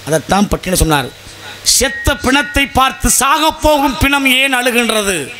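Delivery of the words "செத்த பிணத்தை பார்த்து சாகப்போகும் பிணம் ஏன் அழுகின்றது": high, drawn-out declamation; slow, high-pitched quotation of a saint's verse; verse recitation. Acted. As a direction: declaim it slowly, pitched well up.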